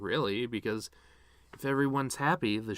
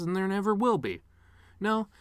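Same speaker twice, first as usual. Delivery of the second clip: higher, louder bitter break; bitter cry; 8 semitones higher, 4 dB louder than usual. Acted. Son eulogizing his mother, rising bitter cry. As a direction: let it crack up into protest.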